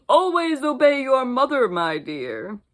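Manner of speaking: loud, sly wolf voice. Acted. Wolf advising the girl, sly and hungry. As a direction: purr the advice with hidden hunger.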